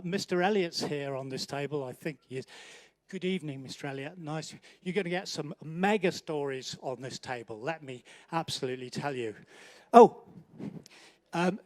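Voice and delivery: ordinary delivery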